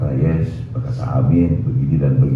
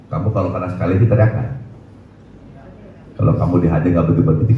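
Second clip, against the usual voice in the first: usual speaking voice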